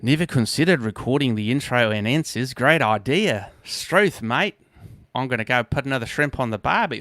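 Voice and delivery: hammed-up Aussie accent; jokey Aussie imitation with stretched vowels; mock Australian accent. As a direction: put on a bad, exaggerated Australian accent. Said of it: "some weird Texas accent here from Australia".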